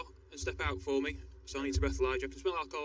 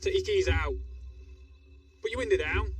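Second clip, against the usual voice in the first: loud, high police commands; shouted commands; 7 semitones higher, 5 dB louder than usual. Real, not acted. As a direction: call it out firmly to the driver. Calling commands at stopped driver.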